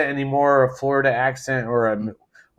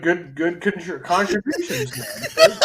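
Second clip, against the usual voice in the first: words breaking into high laughter; laughing; 9 semitones higher, slower than usual. Real, not acted. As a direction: tease the friend through laughter. Laughing at friend's fumble.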